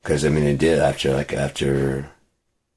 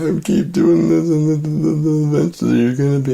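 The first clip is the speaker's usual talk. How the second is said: high, louder mimicry of her; mocking her voice; 12 semitones higher, 4 dB louder than usual. Quoting the ex's threat in a high mocking voice. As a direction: parrot her in a squeaky put-on voice. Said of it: "She was like".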